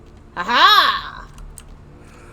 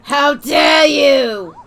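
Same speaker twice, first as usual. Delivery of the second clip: mock outrage; 4 semitones higher, 11 dB louder, much slower than usual. Mock outrage at game character.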